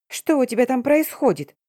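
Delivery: high-pitched, agitated phone demand; urgent, worked-up question; alarmed friend. A narrator's reading.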